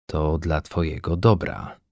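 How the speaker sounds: calm him gently; soothing. A narrator's reading. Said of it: "Powiedział uspokajająco". (uspokajająco, ciszej)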